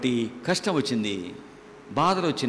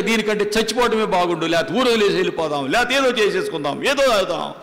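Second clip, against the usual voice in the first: desperate wife; 6 semitones higher than usual. Retold or imitated. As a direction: pour out a wife's hopeless complaint, faster and faster. Preacher voices desperate woman. (నిరాశలో ఉన్న భార్య గొంతు, ఎక్కువ స్థాయిలో, వేగంగా)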